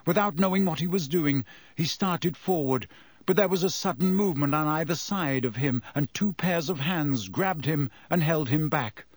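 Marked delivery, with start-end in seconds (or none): none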